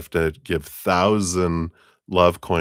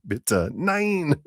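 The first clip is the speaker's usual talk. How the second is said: high, drawn-out German plea; hammed-up German cry through laughter; mock German accent; 10 semitones higher than usual. Joking as the mind to the heart, high mock-German protest. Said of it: "mind's gonna speak in German accent".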